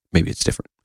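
a knowing little whisper, half to yourself; whispered aside. Real, not acted. Host slipping a snide aside to listeners, whispered. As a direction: breathe it under your breath as a sly dig. Sarcastic aside on Trump's promise.

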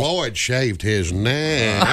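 low, very slow folksy drawl; Andy Griffith. Acted. Sheriff noting the barber's shave, slow low drawl. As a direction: draw it out like a North Carolina small-town sheriff. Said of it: "they talk like Andy Griffith".

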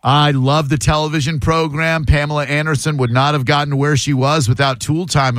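usual speaking voice

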